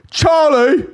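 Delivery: high yelled name; calling out. Retold or imitated. English mum calling her child: high shout. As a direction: shout a kid's name across a crowd. Mimicked parent calling a kid.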